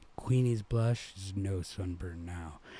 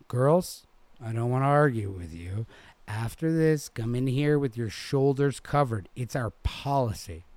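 raised, loud scolding; firm, lecturing the girls; stern manager; 4 semitones higher, 6 dB louder than usual. A narrator's reading.

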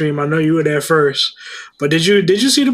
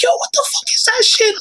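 high panicked laughing yelp; nervous laughter. Retold or imitated. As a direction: squeal it, laughing and scared. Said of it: "I'm like".

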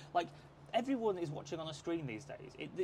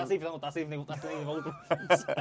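laughing through the words, a bit louder; 7 dB louder than usual. Real, not acted. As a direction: chuckle out the teasing comeback. Teasing about dated slang.

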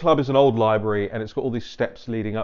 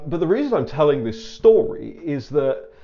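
ordinary talk

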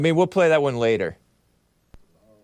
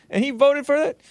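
surprised; 14 semitones higher than usual. Real, not acted. Surprised he voted for it.

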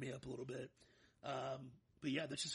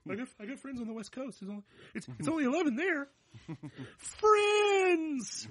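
mock excuse; 12 semitones higher, 14 dB louder than usual. Real, not acted.

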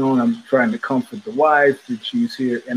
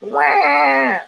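mock newborn wail, high; imitated baby wail; 13 semitones higher, 3 dB louder than usual. Father imitating his newborn's first cry, high wail. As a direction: squeal out a baby's first cry.

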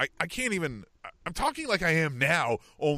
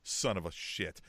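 muttered curse; 10 semitones lower, 7 dB quieter than usual. Retold or imitated. Cursing at spilled gas.

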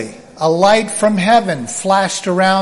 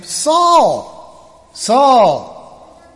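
higher, falling call of a name; voice from heaven; 7 semitones higher than usual. Acted. A voice from heaven to the fallen Saul, calling. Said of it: "heard a voice saying to him".

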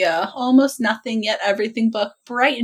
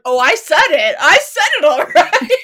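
emphatic; 6 semitones higher, 7 dB louder than usual. Retold or imitated. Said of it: "I was like".

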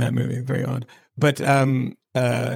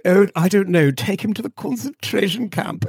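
mock British villain; 6 semitones higher than usual. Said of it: "the evil voice was the British voice".

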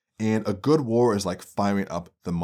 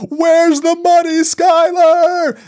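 full-throated scream, much higher and louder; 10 dB louder, much slower than usual. Retold or imitated. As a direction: scream it at the wife in desperate rage. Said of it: "he's under the house screaming".

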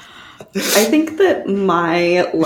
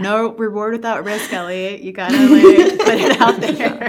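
higher and louder, laughing through it; 4 semitones higher, 4 dB louder than usual. Real, not acted. Egging coworkers on about risky stunts, laughing. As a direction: blurt it out mid-laugh.

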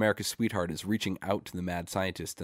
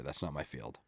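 usual speaking voice

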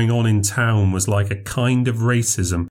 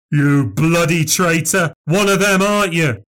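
angry accusation; 7 semitones higher, 3 dB louder than usual. A narrator's reading. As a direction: spit it at the friend. Frank turns on his friend.

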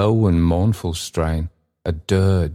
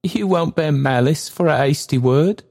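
put-on high, pleading old man; an anxious old porter speaking; 9 semitones higher than usual. Old Trotty speaking, far higher.

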